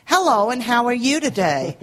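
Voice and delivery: chirpy fake-American Indian call-centre greeting; fake American accent. Mocking bank call-centre staff with fake American accent. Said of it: "an Indian voice with a fake American accent".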